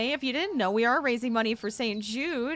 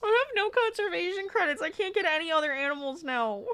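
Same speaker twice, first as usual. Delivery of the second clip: exasperated; 7 semitones higher than usual. Real, not acted. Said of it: "I wanna scream".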